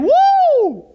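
high, loud whoop of delight; sudden celebratory whoop from the pulpit; joyful whoop. Real, not acted. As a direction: let out a big happy woo. Preacher cheering a favourite promise.

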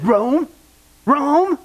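can't believe anyone would go there; incredulous hairdresser. Acted. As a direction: repeat it in rising disbelief. Acted scornful hairdresser hears destination.

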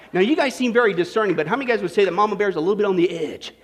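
ordinary delivery

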